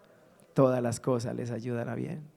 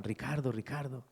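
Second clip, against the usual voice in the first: hushed call; 2 semitones lower, 9 dB quieter than usual. Retold or imitated. Pastor wakes him at night.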